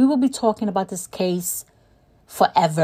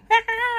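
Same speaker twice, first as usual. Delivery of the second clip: high laugh; 14 semitones higher than usual. Real, not acted. Laugh after the quote.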